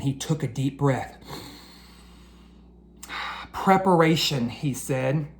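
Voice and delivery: lively, expressive storybook reading; animated children's book narration. A narrator's reading. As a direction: read it like bedtime story time for kids.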